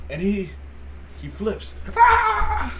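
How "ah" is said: sudden high shout while pretending to flip; flipping scream. Reenacting the store clerk flipping out with a high yelp. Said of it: "That's me doing a flip".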